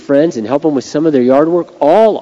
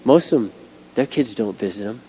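soft, choked-up imitation of his daughter; a mild hint of a tearful daughter; retold teary plea; 3 semitones lower, 8 dB quieter than usual. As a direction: quietly relay her near-tearful words. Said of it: "and she starts crying".

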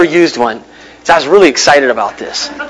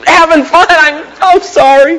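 sheepish apology; 8 semitones higher, slower than usual. Retold or imitated. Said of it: "I was like".

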